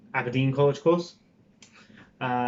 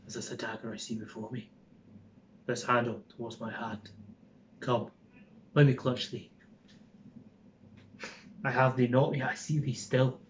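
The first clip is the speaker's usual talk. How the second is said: fast, softer whisper in a gentle Scottish accent; a milder Scottish medium, hushed and quick; 6 dB quieter than usual. Shown as a demonstration. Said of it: "it's still Scottish, but it's not".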